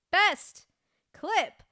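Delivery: loud, slow, drawn-out winner reveal; excited award announcement. Acted. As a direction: build it up like an awards host.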